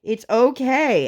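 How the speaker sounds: retold exasperated pleading; exasperated mom. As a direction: beg the kid to stop, stretching the words. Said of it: "I'm like".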